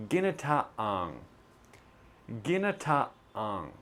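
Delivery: "ginataang" slow, with a clear throat stop in the middle; gi-na-ta-ang, cut sharply in the middle; glottal stop in ginataang. Shown as a demonstration. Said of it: "obvious glottal T right in the middle".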